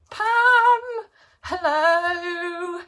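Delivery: high, loud delighted Pam; happy shout of a viewer's name; excited call. Real, not acted. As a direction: call her name with joy.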